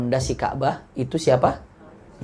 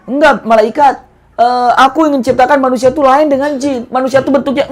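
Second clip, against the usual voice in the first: raised higher, pressing voice for the angel; angel's voice; 9 semitones higher, 6 dB louder than usual. Acted. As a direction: play the protesting angel, high and urgent. (suara malaikat yang memprotes, lebih tinggi)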